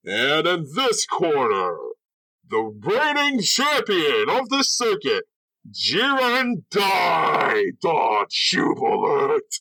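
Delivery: high, drawn-out wrestling announcer call; ring announcer. Acted. Announcer calls the champion's name out to the crowd, ringing. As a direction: stretch every big word to the arena rafters. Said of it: "the announcer kinda, like, calls his name out".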